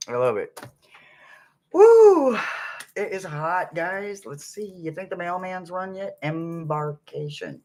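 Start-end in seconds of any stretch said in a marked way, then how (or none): 1.7-2.4 high, loud "woo"; whoop. Real, not acted. Streamer feeling the heat on a live, high loud whoop. 6.2-7.6 slow, soft "embarkation"; said slowly. Real, not acted. Streamer practising the new word to self, slow soft "embarkation". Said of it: "I have to say it slowly".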